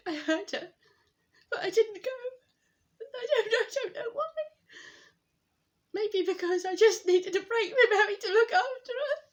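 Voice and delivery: high, loud voice breaking into crying; tearful confession. Acted. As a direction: choke out the guilt through rising tears. Guilty confession about dying grandmother's call.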